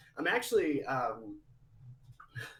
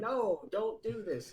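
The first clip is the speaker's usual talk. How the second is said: pleading voice; 10 semitones higher, 3 dB louder, slower than usual. Retold or imitated. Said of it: "is what they said".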